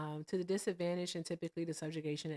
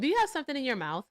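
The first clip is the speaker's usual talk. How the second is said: louder, faster, higher and insistent; insistent question; 8 semitones higher, 6 dB louder, faster than usual. Real, not acted. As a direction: demand it, pressing hard. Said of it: "Can we stop for just a moment?".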